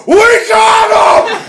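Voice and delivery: stretched-out, very high victory shout; triumphant holler. Retold or imitated. Man hanging out his window to celebrate, triumphant holler. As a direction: yell it slowly out of a car window.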